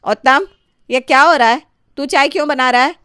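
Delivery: high, loud mother's scolding; mother shouting at her son over the tea. Acted. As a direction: call out sharply at the son.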